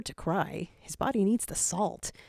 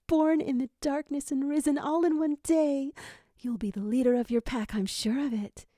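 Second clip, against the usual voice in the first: proud mother; 8 semitones higher, 3 dB louder than usual. A narrator's reading. Mother praises newborn.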